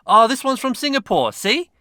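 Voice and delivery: delighted discovery of a far-off gift; surprised. Real, not acted.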